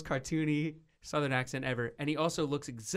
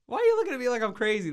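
exasperated; 8 semitones higher, 6 dB louder, much faster than usual. Real, not acted. Defending her memory, exasperated.

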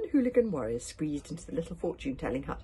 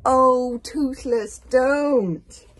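loud, high wail; alarmed plea; 8 semitones higher, 15 dB louder, much slower than usual. Acted. Begging Toothless not to go in, alarmed wail. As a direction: cry it out in dismay.

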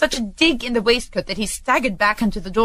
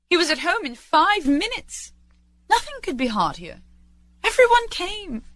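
high, rapturous storytelling; exuberant narration; 8 semitones higher than usual. A narrator's reading. Narrator tells of Scrooge's warm welcome, exuberant. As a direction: tell it bubbling over with joy, pitched up.

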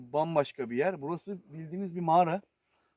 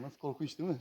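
usual speaking voice

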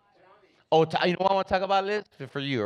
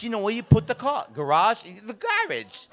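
put-on high English accent explaining "garage"; mock English accent; 7 semitones higher than usual. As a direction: imitate the cousin insisting on "garage", pitched up. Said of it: "to see her talk like that's weird".

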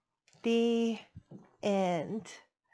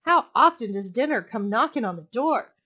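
loud; 8 dB louder than usual. Acted. Fox gloating.

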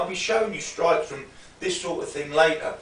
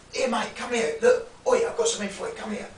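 higher, pushy come-here call; lightly voiced street geezer beckoning; shifty geezer; 6 semitones higher, faster than usual. Shown as a demonstration. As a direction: lure the victim over, mock-friendly. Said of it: "Some geezer might be like this".

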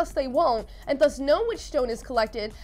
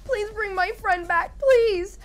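high, pleading wail, hammed up; mock tearful screaming; 7 semitones higher, slower than usual. Odette praying over her dead friend's body, tearfully pleading. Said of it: "like, screaming and crying over Rasmus's body".

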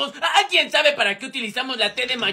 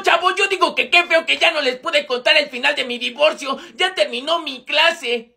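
ordinary talk, nothing marked

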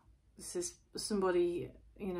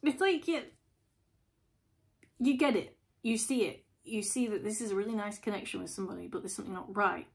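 ordinary delivery

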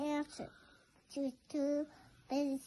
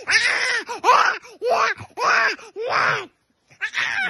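loud, high, happy yelling; excited shouts; 7 semitones higher, 14 dB louder, slower than usual. Real, not acted. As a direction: shout for joy.

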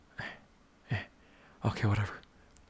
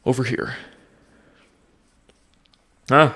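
usual speaking voice

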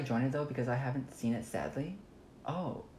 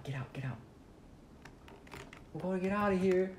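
normal talking voice

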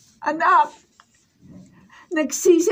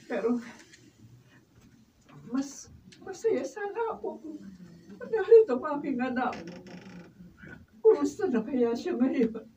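much softer, wistful lament; sad and quiet; 11 dB quieter than usual. Acted. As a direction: hush it, missing her child. Mother sadly misses her daughter. (malungkot at mahinang pananabik)

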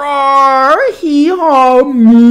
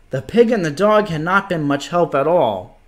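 usual speaking voice

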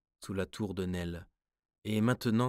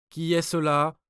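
higher, much louder, frightened repeat; trembling challenge; 8 semitones higher, 10 dB louder than usual. A narrator's reading. Swiss guard repeats his challenge, trembling and loud. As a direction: shout it again, scared now. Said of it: "répéta le Suisse d'une voix tremblante".

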